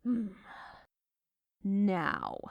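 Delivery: ordinary talk, nothing marked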